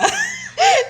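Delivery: squealing burst of laughter; high shrieking laugh. Real, not acted. Big laugh.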